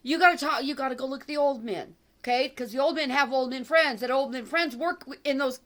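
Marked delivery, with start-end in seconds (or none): none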